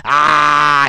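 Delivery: loud mock scream of ah; hammed-up scream impression; imitated scream. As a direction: belt out a short imitated scream. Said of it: "that's pretty much how he says".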